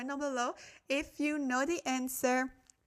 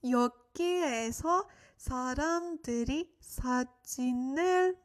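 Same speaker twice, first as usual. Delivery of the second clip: slower phrase-by-phrase model reading; slow it down with pauses between chunks for learners; a little slower; faster than usual. Shown as a demonstration. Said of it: "repeat a little bit slower for you guys".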